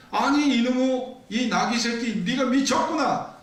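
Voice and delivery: high-pitched, indignant outburst; acted angry scolding. Preacher as injured Balaam cursing his donkey, high angry scolding. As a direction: lash out at the animal in acted fury.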